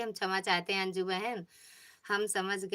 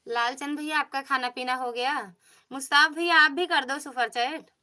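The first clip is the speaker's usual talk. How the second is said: louder, higher call to viewers; raised voice, pitched up; 5 semitones higher, 7 dB louder than usual. Real, not acted. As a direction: call out to get their attention. Calling out to chat viewers again.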